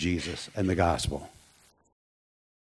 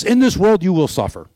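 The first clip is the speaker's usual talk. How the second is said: high and loud, ringing out the verse; loud quoted promise; 9 semitones higher, 6 dB louder than usual. Retold or imitated. Preacher quoting Jesus to the congregation, loud and high. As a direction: proclaim it like a preacher at full force. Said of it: "Here's a promise by Jesus".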